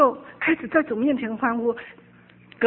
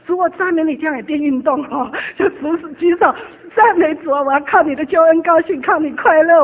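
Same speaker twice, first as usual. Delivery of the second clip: loud, high praise with hands raised; exultant praise; 4 semitones higher, 8 dB louder than usual. Retold or imitated. Retelling daily praise with raised hands, loud exultant. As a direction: lift hands and praise with all your strength. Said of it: "我就举手".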